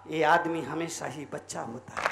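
very low, soft voice; hushed, low-pitched wish; quiet musing. Shown as a demonstration. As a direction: drop low and muse softly. Soft first take, then shouted repeat.

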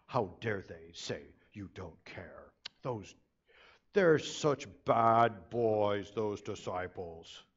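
self-righteous churchgoer, slow and hammed-up; tut-tut at the bad disciples; mock pious scolding. Churchgoers judging the disciples, mock sanctimonious.

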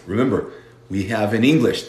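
ordinary delivery

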